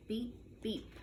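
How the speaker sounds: usual speaking voice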